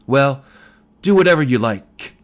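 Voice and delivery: loud careless retort; crying out. A narrator's reading. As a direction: fling it back loudly. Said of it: "Life cried".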